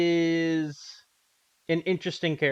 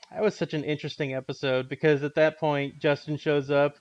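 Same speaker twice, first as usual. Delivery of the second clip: normal talking voice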